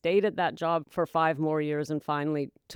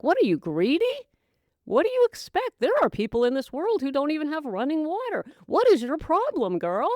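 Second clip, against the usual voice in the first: scolding self; 8 semitones higher than usual. Retold or imitated. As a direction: scold yourself as if for greed. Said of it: "And you're like".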